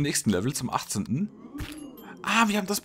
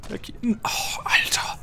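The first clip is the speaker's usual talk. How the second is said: whispered; 9 semitones higher, slower than usual. Real, not acted. Whispered exclamation.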